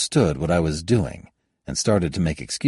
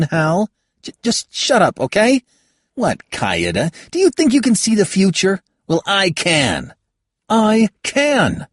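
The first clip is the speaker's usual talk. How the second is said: put-on higher, louder, exasperated young woman; young woman scolding; 11 semitones higher, 6 dB louder than usual. Kayada scolds Halbert for having no opinion, higher and louder. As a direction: cut him down with rising heat.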